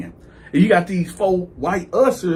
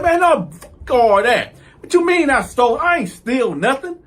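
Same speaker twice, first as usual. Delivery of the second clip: loud, high-pitched refusal to calm down; indignant outburst; 6 semitones higher, 4 dB louder than usual. Retold or imitated. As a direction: wave off the accusation angrily. Retold denial, refusing to calm down.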